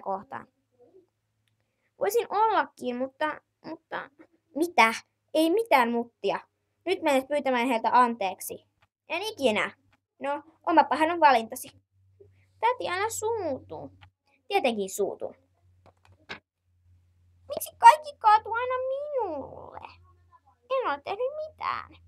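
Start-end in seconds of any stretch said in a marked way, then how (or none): 2.0-4.1 put-on high, young child's voice calling auntie; little child asking auntie. Child figure hesitates. 12.6-13.9 put-on high, young child's voice calling auntie; little child asking auntie. 17.5-22.0 put-on wailing child complaining to herself.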